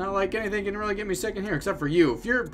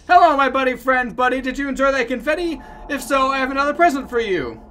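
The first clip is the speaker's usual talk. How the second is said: high-pitched chirpy mascot voice; relentlessly cheerful game companion; happy buddy; 6 semitones higher, 4 dB louder than usual. Acted. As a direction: greet them with sugary, high enthusiasm. Voicing the game's happy buddy.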